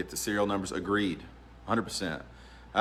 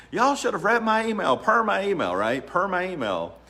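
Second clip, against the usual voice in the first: much higher and louder, mocking and passive-aggressive; snippy mock reply; 10 semitones higher, 4 dB louder than usual. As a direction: sneer it like a petty email reply. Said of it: "jump in that river and be like".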